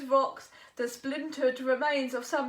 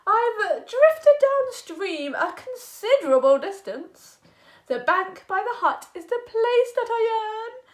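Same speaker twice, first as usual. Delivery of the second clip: a louder, higher earnest little frog; frog pleading; 5 semitones higher, 9 dB louder than usual. Acted. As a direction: plead politely for rescue.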